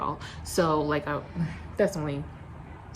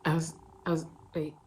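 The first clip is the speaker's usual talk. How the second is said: plain reading voice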